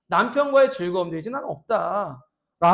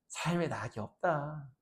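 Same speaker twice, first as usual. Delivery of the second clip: slow, soft, drained complaint; low listless murmur; weary sigh; 5 semitones lower, 10 dB quieter, slower than usual. Pretend. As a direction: say it like life has lost its taste.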